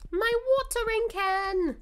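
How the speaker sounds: high, loud lament; dismayed cry. Real, not acted. Streamer laments the missing watering can, high dismayed cry. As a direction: wail it in dramatic dismay.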